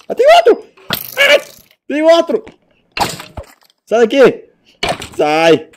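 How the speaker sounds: very high, loud alarmed cries; panicked yelling. Real, not acted. Player swarmed by monsters, frantic shouting. More monsters appear in game.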